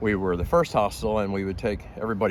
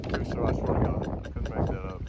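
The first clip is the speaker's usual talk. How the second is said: ordinary talk, nothing marked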